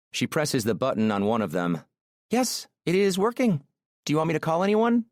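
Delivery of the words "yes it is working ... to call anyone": high, lilting woman's voice, quickening; Aurora, eager. Acted. Woman setting up phones for her driver, high and eager. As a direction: report it brightly, then ask quickly.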